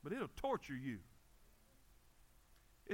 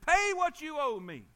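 loud, higher, hammed-up threat; acted angry demand; 5 semitones higher, 10 dB louder than usual. Forgiven man grabs his own debtor, loudly demanding. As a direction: play a creditor demanding money.

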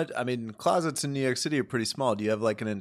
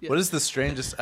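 laughing; 7 semitones higher than usual. Real, not acted.

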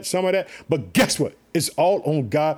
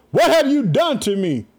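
higher-pitched accusing demand; raised, declaimed confrontation; sharp demand; 6 semitones higher, 3 dB louder than usual. A narrator's reading. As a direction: read it as a ruler's accusation. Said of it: "he demanded".

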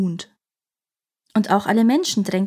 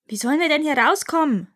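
shouting back; 6 semitones higher than usual. Acted. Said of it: "schrie Unanana zurück".

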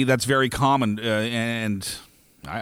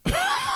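high short laugh. Real, not acted. Laugh before offering story.

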